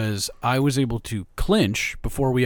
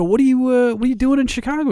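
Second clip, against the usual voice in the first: high, eager question; 11 semitones higher than usual. Retold or imitated. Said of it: "I go".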